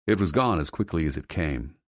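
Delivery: ordinary delivery, nothing marked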